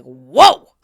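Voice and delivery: loud, low startled whoa; shocked whoa. Retold or imitated. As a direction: react like a scandalized mom. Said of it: "the moms were looking at me like".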